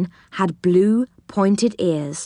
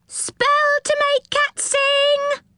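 much higher, slow squeaky hawker; very high cry; slower than usual. A narrator's reading. Another gnome selling spells, very high cry. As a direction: hold the call up high. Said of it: "cried another gnome".

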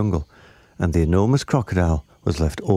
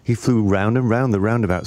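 ordinary delivery

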